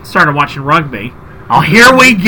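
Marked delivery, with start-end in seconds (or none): 1.5-2.3 exasperated. Retold or imitated. Guy's exasperated reply acted.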